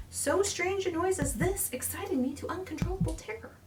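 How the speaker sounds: high, whispered dread; terrified whisper. A narrator's reading. Narrator gripped by terror at night, high whisper. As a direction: breathe the terror out unvoiced.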